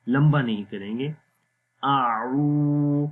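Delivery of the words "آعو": "آعو" with the oo held one alif long, very slow; slow Quranic lengthening; lengthened oo. Shown as a demonstration. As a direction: stretch the "عو" out like recitation. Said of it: "او کو لمبا کریں گے".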